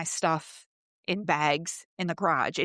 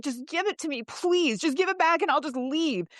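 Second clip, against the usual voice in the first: high, urgent begging; retold pleading; 8 semitones higher than usual. Woman begging him to hand back the title, high retold pleading. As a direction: plead for it in a raised pitch.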